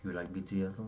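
put-on playful Korean-accented English. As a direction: ask it in a light Korean parody accent. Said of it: "You have to say the whole thing".

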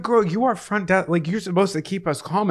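high-pitched sassy reproach; incredulous scolding. Retold or imitated. Guest chiding front desk worker, incredulous. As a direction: tell her off with pitch way up.

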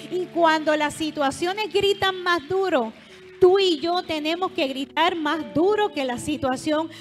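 loud, driving sermon voice; preaching at full force. Acted. Pastor urging the congregation to keep praying, loud preaching. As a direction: push every phrase out to the congregation.

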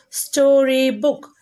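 "Storybook" stretched slowly over three syllables; slow teacher's model of "Storybook"; syllables sounded out. Shown as a demonstration. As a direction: draw out each part of "Storybook". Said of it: "These are three syllable words".

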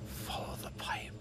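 whispering while hiding. Real, not acted. Hiding from the lady.

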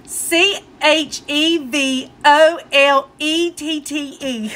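letters spelled out slowly one by one; slow spelling. Retold or imitated. Husband spells his truck's name, slow letter by letter. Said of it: "He said".

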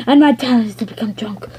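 put-on fading drunken whisper to nothing. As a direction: trail off sleepily. Drunk toy fades.

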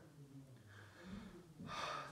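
long soft sigh; a weary sigh before she answers. Acted. Queen sighs before reply.

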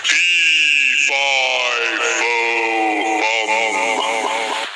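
booming giant, slow and low. Acted. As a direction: roar it out like a giant. Giant's voice heard by Jack.

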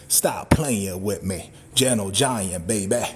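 drawn out low and slow; low slow catchphrase. Acted. Cowboy teasing viewers about his height, low and slow. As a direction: lean back and purr it low.

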